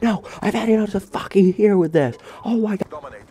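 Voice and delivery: very high-pitched imitation of a screaming teacher; mock screaming. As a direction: act out the teacher's screaming meltdown without full volume. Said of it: "he screamed like that".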